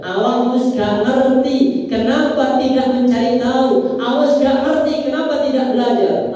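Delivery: angry angel. Acted. Angel rebuking the unbeliever for not learning, angry. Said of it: "Apa bentuk malaikat marah?".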